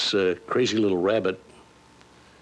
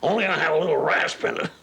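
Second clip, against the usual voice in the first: higher cowboy voice with a little rasp in it; Yosemite Sam; 7 semitones higher than usual. Retold or imitated. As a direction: play the hot-tempered little cowboy, rasp it out. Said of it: "Yosemite Sam's the one that talks like that".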